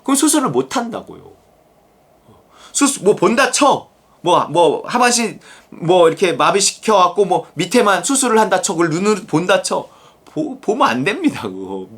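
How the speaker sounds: ordinary talk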